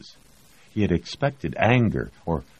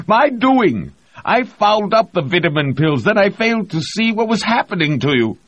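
much higher, loud, strained cry; anguished confession; 8 dB louder than usual. A narrator's reading. Reed confessing his fault to the crew, high and loud. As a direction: pour out the guilt at full voice.

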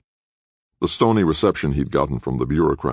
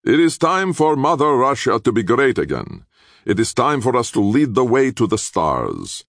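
higher, louder grand proclamation; a president rousing the parliament; proclaiming president; 10 semitones higher, 4 dB louder than usual. A narrator's reading. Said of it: "and pronounced".

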